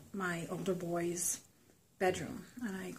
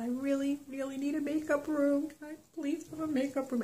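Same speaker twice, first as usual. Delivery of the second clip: pleading; 8 semitones higher than usual. Retold or imitated. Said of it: "and I said".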